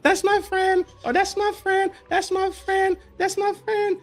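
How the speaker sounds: high, sing-song kid voice mocking adults; childish friend talk. Mocking childish grown-up about his friend, high kid voice. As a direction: squeal it like a little kid showing off a friend.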